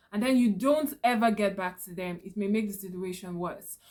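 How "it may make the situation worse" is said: ordinary talk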